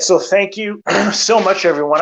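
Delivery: hoarse, tired voice after long talk; losing voice. Real, not acted. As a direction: voice worn out, slightly hoarse. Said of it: "I think I'm losing my voice".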